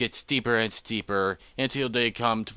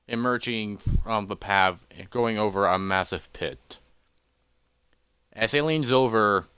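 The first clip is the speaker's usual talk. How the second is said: usual speaking voice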